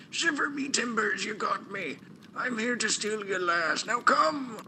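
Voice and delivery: high, loud hammy pirate. Acted. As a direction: growl it like an air pirate. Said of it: "there's pirate voice".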